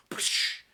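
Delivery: loud breathy "Psh!"; smack sound. Retold or imitated. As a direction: imitate a slap landing.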